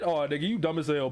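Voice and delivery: ordinary talk, nothing marked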